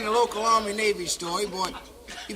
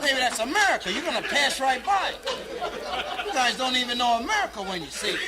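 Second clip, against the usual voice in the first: impatient Chris; 5 semitones higher, 5 dB louder than usual. Retold or imitated. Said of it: "Chris said".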